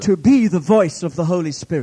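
loud, emphatic proclamation; fervent preacher at full voice; full-force preaching. Real, not acted. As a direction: drive it out to the whole congregation.

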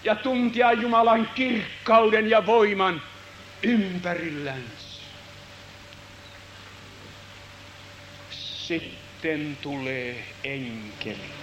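very soft, slow, low telling; hushed awe. Acted. Preacher closing the vision, hushed awe. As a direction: let it fade into silence.

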